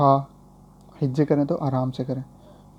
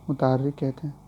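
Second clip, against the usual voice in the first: ordinary delivery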